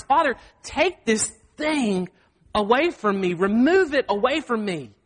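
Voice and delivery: slow, drawn-out imitated plea; crying out to God. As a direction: plead to God, stretching the words. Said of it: "he cries out to God".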